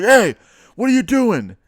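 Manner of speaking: high, loud mock yell as the cop. Cop yelling at a jaywalker from his cruiser, high and loud. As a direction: bark it out like a cop stopping someone. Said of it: "He's like".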